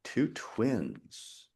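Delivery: soft, musing to oneself; thinking aloud. Real, not acted. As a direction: quietly turn the question over. Thinking aloud over quiz question.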